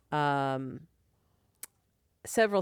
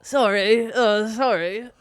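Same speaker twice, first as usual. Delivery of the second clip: loud, raised mock apology; 5 semitones higher, 7 dB louder, slower than usual. Host joining co-host's bat-biting joke, mock apology. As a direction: imitate a rock star's hapless apology. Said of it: "if [name] would have shot someone".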